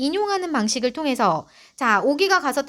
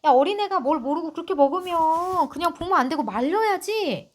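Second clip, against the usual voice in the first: higher, reproachful scolding neighbour; 6 semitones higher than usual. Acted. Narrator scolding the mother, mildly reproachful. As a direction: scold her for not stopping the child. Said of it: "하고 탓을 합니다".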